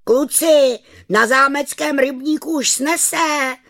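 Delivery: loud, high schoolboy announcement; announcing. A narrator's reading. Vojta announces to classmates at school, high announcing call. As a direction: call the news out to the whole gang. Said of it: "hlásil Vojta Beranovic po ránu ve škole".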